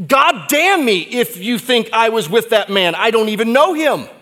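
loud, high, furious oath; loud cursing denial; angry cursing denial. Acted. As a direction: spit out the curse. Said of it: "It's like saying".

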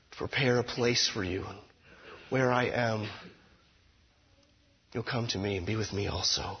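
soft, hushed reassurance; gentle comfort. Acted. Preacher voicing Jesus' comfort to the fearful, hushed. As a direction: speak like a gentle hand on the shoulder.

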